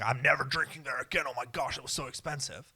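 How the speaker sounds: hammed-up indignant customer; mock horror at the price; mock outrage. Coffee drinker acting out an overreaction to the price, mock outrage. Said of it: "it definitely wasn't like".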